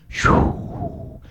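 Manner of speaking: voiceless rushing "shoo"; whoosh. Retold or imitated. Storyteller imitates the bike racing downhill, whooshing. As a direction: imitate speeding downhill with one long breath.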